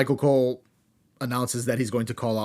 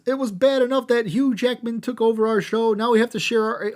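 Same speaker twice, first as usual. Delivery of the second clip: mock Vicky voice; 9 semitones higher than usual. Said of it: "Vicky comes out and says".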